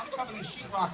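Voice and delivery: ordinary talk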